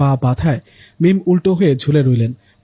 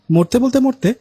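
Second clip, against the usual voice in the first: flustered; 9 semitones higher than usual. Acted. Mim flustered, making excuses.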